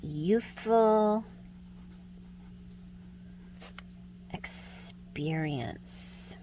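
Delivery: soft slow whispered poem; poem reading. Acted. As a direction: breathe the lines out gently. Reads acrostic poem slowly.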